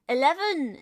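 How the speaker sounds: eleven said in standard English, plain and even; eleven, the way a posh Brit says it; English-accent eleven; posh British eleven. Shown as a demonstration. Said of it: "is how I normally say it".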